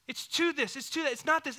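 normal talking voice

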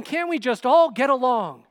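high, loud peacemaking plea; oblivious husband pleading. Acted. Oblivious husband to his two wives, exasperated pleading. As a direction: appeal to the whole room, loud and high. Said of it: "Elkanah is oblivious to it all".

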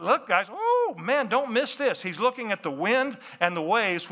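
usual speaking voice

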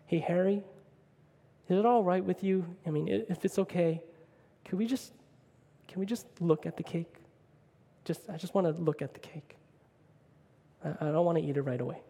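a soft, hushed plea; woman asking the diner owner to keep her cake, softly; softly spoken. Retold or imitated. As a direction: ask gently, almost afraid to impose. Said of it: "she softly says".

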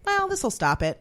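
high, mock self-assured line; mock inner voice. Joking to cohosts, a high mock inner voice. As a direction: squeak it out like a silly thought.

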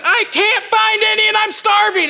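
high, loud desperate cry; desperate shout. Retold or imitated. Starving man shouting to the host, high and desperate. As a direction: shout it high, begging for food.

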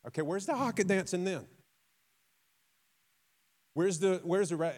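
mock dismay. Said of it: "And you're like".

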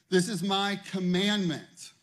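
slow, loud, weighty scripture proclamation; preaching at full force. Acted. Preacher proclaiming God's command to the congregation. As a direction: thunder God's command slowly.

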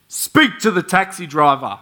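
imitated forceful divine order; urgent command. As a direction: boom it out as an insistent command. The Lord's urgent repeated command.

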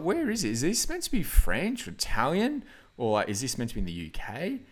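high, puzzled questions, pitch jumping up; baffled. Retold or imitated. Retelling googling the accents, high baffled questions. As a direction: act out being lost over the accents.

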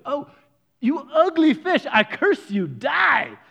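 high, loud theatrical curse; hammed-up dad cursing, building to a shout; mock curse.

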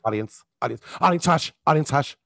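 curt, repeated hushing in imitation; mock a host calling a crowd to order; host hushing the audience. Game show host to studio audience, curt hushing. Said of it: "turn to the audience and go".

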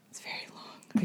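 whispered aside, very soft; whispering. Shown as a demonstration. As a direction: breathe it as a secret aside. Repeats the complaint whispered.

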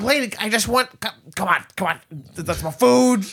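high cartoon bird pleading; high hawk voice. Hawk begs the lady for its food, high voice. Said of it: "He's like".

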